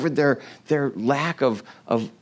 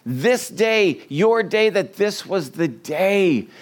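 high, drawn-out cry of lament, easing off; impassioned lament; 5 semitones higher, slower than usual. Acted. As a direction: lament out over the city as a preacher voicing Jesus. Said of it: "He lamented".

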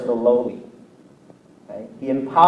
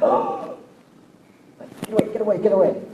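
high gasped oh, then soft frantic protest; mock alarm; 6 semitones higher, 7 dB quieter, faster than usual. Preacher imagines someone reading your journal, playfully panicked. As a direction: gasp high, then softly shoo them off.

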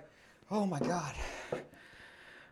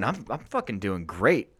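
ordinary talk, nothing marked

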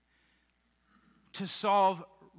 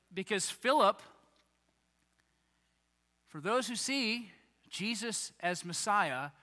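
ordinary talk

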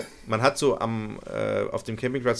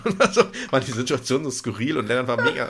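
ordinary talk

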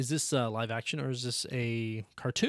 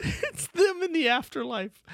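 much higher, slow ghostly voice; spooky voice; 14 semitones higher, 3 dB louder, slower than usual. Acted. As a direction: drawl it like a ghost. Joke about dead characters.

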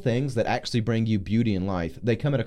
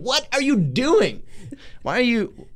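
slow, much higher, pleading rebuke; exasperated Gabriel; 10 semitones higher, slower than usual. Acted. Gabriel shaking Zechariah, slow exasperated high rebuke. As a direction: shake him by the shoulders with words. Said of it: "you got louder and slower".

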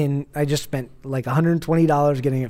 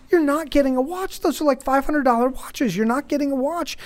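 high, pleading dad voice; acted appeal to a child's sympathy; emotional guilt-tripping; 8 semitones higher than usual. Dad working on his son's emotional side, guilt-tripping plea. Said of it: "trying to play with, like, his emo-, his emotional side".

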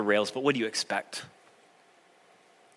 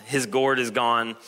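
usual speaking voice

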